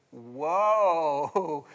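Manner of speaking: a playful husband's swoon; mock swoon. Pastor joking about his wife Lisa, mock swoon. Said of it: "see how I did that right there?".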